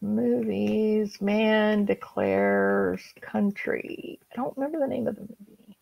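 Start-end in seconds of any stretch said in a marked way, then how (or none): none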